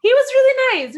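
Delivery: high, loud gushing. Real, not acted. Praising the driver, loud and gushing. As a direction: rave about him.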